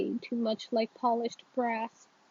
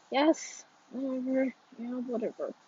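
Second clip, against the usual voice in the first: put-on soft, dismissive teenage mumble; quiet, grudging brush-off; sullen mumble; 5 dB quieter than usual. A narrator's reading. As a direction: mumble it under your breath. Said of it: "I mumble".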